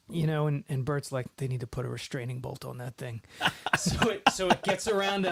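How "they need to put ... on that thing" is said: low, soft gruff grumble; lightly voiced gruff guard; grumpy bodyguard. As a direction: grumble it like a bodyguard who hates droids. Bert voiced, droid complaint.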